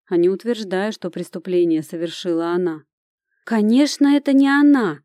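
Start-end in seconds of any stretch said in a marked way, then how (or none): 3.5-5.0 brightening up, loud and high; eager. Acted. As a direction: jump in eagerly. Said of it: "оживилась Катя".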